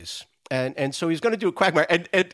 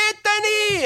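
mom yelling out window; slower than usual. Retold or imitated. Italian mom calls her son from the window, high yell. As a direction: call your boy home from the window. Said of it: "his mom would yell out the window".

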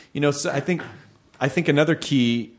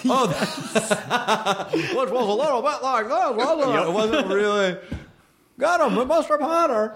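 high, hammed-up old film star impression; impressionist's caricature, high all through; Jimmy Stewart; 11 semitones higher than usual. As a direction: do an exaggerated impression, pitched way up. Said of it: "impression of Jimmy Stewart".

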